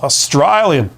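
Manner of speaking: put-on Australian accent, broad vowel in "Australian"; playful imitation of an Australian accent. Shown as a demonstration. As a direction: say "Australian" like a mock Aussie. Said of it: "with an Australian accent".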